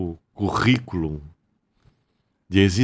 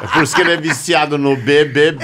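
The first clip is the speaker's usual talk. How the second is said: loud, higher, quick through laughter; laughing tease; 5 semitones higher, 8 dB louder, faster than usual. Real, not acted. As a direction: blurt it out loudly, cracking up. Teasing amid group laughter.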